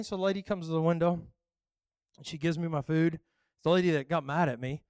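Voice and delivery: ordinary talk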